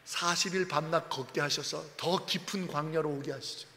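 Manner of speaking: usual speaking voice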